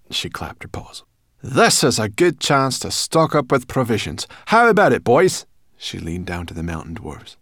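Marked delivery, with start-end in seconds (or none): 1.4-5.5 loud, raised character voice; brisk-minded brownie, pitched up and forceful; bossy brownie. A narrator's reading. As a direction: take charge as a pert little brownie. Sorrel addresses the dwarfs.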